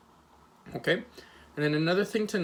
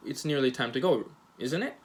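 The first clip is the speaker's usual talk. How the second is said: "isn't it" rising at the end; rising question tag. Shown as a demonstration. Teacher asking as if unsure of the time, rising intonation. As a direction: really ask with an upturned "isn't it". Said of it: "you'd like to know the answer".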